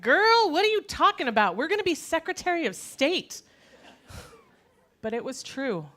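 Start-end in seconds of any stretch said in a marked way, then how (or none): 0.0-3.4 high, loud, outraged sass; appalled inner feminist. Acted. Inner feminist scolding her younger self, appalled and loud. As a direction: protest in disbelief, pitched way up. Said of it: "My little inner feminist was appalled".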